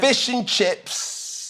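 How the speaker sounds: high, stretched-out British accent; exaggerated slow, high British accent; mock British accent. Shown as a demonstration. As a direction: draw it out slowly in a put-on British accent. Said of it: "British accent. Let me hear something".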